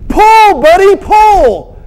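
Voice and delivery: high, loud drawn-out shout; farmer's yell. Acted. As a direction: bellow a command to the horse. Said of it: "the farmer yelled".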